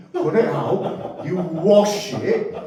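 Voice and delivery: slowed right down, laughing through the steps; slow, laughing recipe demo; slow laughing instructions. Shown as a demonstration. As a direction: spell out each step slowly while cracking up. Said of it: "Really important to wash it".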